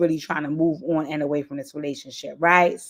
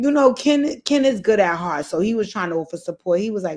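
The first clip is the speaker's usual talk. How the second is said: normal talking voice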